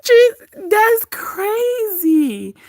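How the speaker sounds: high, drawn-out squeal through laughter; laughing hard. Real, not acted. Reacting to wild gossip, helpless laughter. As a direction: gasp it out while cracking up. Recovering from a long laughing fit.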